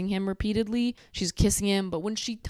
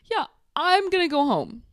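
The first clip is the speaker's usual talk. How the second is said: higher, chirpy quick agreement; mock eager reply; 8 semitones higher, 4 dB louder than usual. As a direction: blurt it like relief. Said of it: "And she's like".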